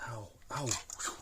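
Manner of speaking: hushed ow from a burn; pained whisper. Real, not acted. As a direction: whisper the ow after touching something hot. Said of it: "that's hot".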